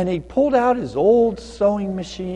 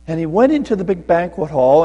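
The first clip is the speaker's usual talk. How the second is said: usual speaking voice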